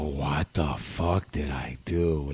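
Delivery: slow, low and soft, asking himself; rueful self-question. Retold or imitated. Sitting back, asking himself what he did, slow and quiet. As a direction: wonder quietly what went wrong. Reflecting on past regrets.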